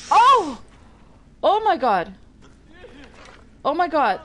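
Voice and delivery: loud high startled shout; shocked cry. Real, not acted. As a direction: jump back with a yell. Snake rattles.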